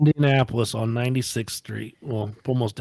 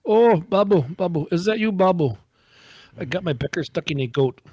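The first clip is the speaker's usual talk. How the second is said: lightly put-on caller, pitched up on the name; prank message voice; 5 semitones higher than usual. Prank caller leaving Babu a message, put-on voice. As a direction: call out for Babu in a mock voice. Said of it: "I left a message like this".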